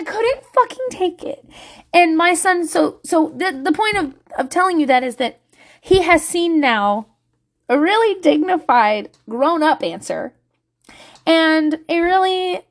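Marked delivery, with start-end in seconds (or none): none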